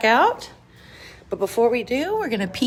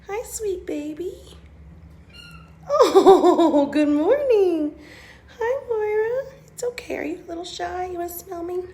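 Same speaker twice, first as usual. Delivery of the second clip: talking to a cat; 6 semitones higher than usual. Real, not acted. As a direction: coo gently at a shy kitten. Greeting shy cats in shelter.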